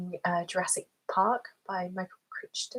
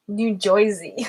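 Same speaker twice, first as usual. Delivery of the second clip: louder, stretched New Jersey "Joisey"; New Jersey accent; 8 dB louder, slower than usual. Shown as a demonstration. Streamer repeating it for a viewer, broad New Jersey accent. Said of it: "say New Jersey".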